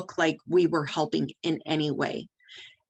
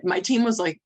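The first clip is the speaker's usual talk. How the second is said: ordinary talk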